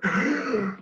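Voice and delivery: high, sharp imitated gasp of shock; mock class gasp. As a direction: gasp as if the whole room is scandalized. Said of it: "And the whole class went".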